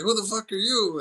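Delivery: high, harsh mock thug shout; tough guy yell. Thug yelling at the hostage taker. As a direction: snarl it like a movie thug. Retelling Con Air, thug threatens.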